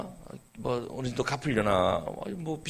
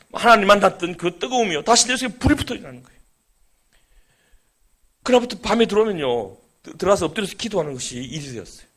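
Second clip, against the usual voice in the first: fervent testimony; 4 semitones higher, 4 dB louder than usual. Acted.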